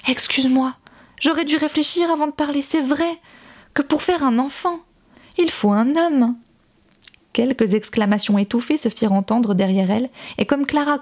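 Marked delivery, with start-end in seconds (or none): 0.1-6.4 mock-sorry apology, pitched higher; fake contrition. A narrator's reading. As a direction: feign remorse with a sly edge. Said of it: "d'un air faussement contrit".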